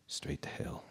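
hushed, breathy whisper of doom. Acted. Drunk narrator whispering his grim story. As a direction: breathe it out like a dark secret.

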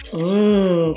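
usual speaking voice

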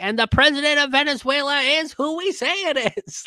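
high, put-on mocking proclamation; mock self-appointed authority. Imitating powers who name Venezuela's president, high mock proclamation. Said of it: "you guys can't just be like".

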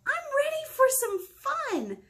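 high eager pumpkin voice; excited squeal. Acted. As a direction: squeal with glee.

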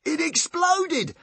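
high, slow, drawn-out wonder; marvelling excitedly. A narrator's reading. Tsar telling Bodkin about the explosion, marvelling. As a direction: savour the explosion. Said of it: "marvelled Tsar excitedly".

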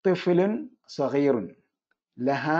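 each word set out slowly, തിഫ്ലുൻ weighted; unhurried demonstration for students; slow model answer. Shown as a demonstration. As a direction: dictate it slowly to the class. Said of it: "ലഹ എന്നത് ഉപയോഗിച്ചു".

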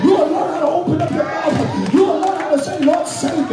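high-pitched sermon climax in short driven phrases; impassioned preaching. Real, not acted. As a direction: cry it out to the congregation, building in fervor.

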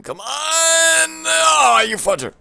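high, loud frustrated shriek; rage. Real, not acted. Gamer raging at the game, high frustrated scream. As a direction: scream at the screen.

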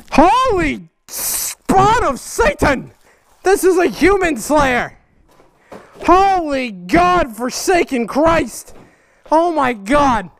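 slow, drawn-out shocked exclamations; stunned by the monstrous sword; awed exclamations. Real, not acted. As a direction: proclaim it like a terrified witness.

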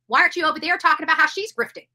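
usual speaking voice

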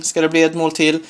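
ordinary delivery

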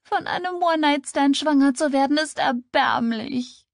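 high, tearful wail; sobbing. A narrator's reading. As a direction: sob it out in self-disgust, dragging the end. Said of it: "schluchzte sie". (schluchzend, mit hoher Stimme)

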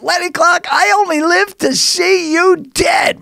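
high, slow hammed-up screech; old lady yelling. Old lady neighbor yelling she wants Lenny dead, high slow mock scream. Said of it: "she yelled at the top".

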